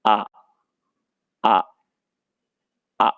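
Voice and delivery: drawn-out आ with the mouth wide open; open-mouth aa sound. Shown as a demonstration. Teacher showing pupils the sound of a mouth opening, drawn-out आ. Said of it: "मुंह खोलने पे हम कैसे react करते हैं".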